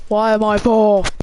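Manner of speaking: real frustrated yell at the game; outraged shout. Real, not acted. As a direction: bellow it at the screen. Angry at placing fourth in qualifying.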